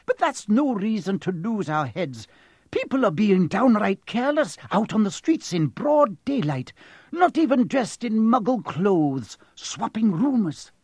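high-pitched, irritated scolding; irritable. A narrator's reading. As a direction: scold like a stern, exasperated professor. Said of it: "said Professor McGonagall irritably".

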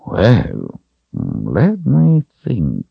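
drawn-out, musing wizard voice; pondering wizard. A narrator's reading.

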